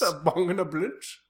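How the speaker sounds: hammed-up silly Dutch villain; Goldmember. Improviser echoing the host's quote, mock Dutch accent. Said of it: "Gold member?".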